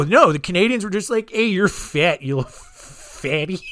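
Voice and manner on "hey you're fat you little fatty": slow, lightly hammed Canadian teasing; silly Canadian voice. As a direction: drag out "little fatty" in a goofy Canadian drawl. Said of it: "I don't know what Canadian voice that is".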